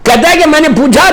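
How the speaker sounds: normal talking voice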